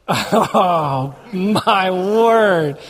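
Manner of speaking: drawn-out, put-on amazed groan of words; mock astonishment. Reacting to the boss's blessing, mock astonished. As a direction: stretch it out, acting floored.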